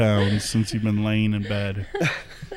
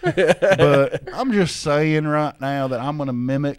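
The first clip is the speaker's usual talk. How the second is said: playful hillbilly accent, stretching the words. Shown as a demonstration. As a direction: mimic a hillbilly Southern accent. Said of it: "that's more of a hillbilly accent".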